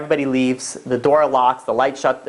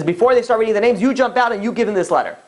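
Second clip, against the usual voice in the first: higher-pitched insistent telling; urgent instruction; 7 semitones higher than usual. Retold or imitated. Rabbi instructing his frightened assistant, urgent. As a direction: press the instruction home in a higher voice.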